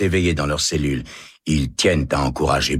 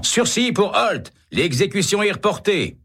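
shouting. A narrator's reading. Governor's envoy crying out the reprieve. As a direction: call it out as you burst in. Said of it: "est arrivé en criant".

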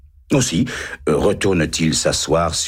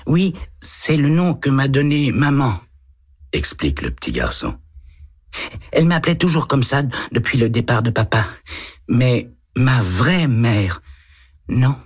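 put-on higher boyish voice, a touch hesitant; little boy voice; 9 semitones higher than usual. A narrator's reading. Boy answering the lieutenant, higher child voice. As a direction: read it as a timid eight-year-old.

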